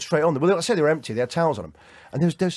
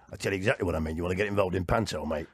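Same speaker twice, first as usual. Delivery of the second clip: put-on much lower and softer, a confiding old pro; imitating Paul Shane; 11 semitones lower, 5 dB quieter than usual. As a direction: drop low and lean in like the veteran comic. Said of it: "He goes".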